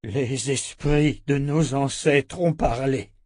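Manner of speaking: slow, weighty pronouncement; measured and grave; solemn elder. Acted. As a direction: speak slowly, as if passing on the ancestors' verdict.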